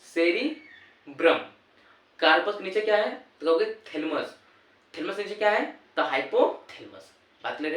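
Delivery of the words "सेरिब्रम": सेरिब्रम said slowly and louder; teacher stressing सेरिब्रम as the answer; emphatic answer. Shown as a demonstration. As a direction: hammer home सेरिब्रम like the key answer. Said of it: "आप क्या कहोगे?".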